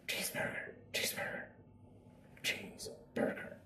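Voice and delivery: hushed, dreamy repetition; whispered cheeseburger. Shown as a demonstration. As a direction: whisper the craving to yourself. Free thought demo.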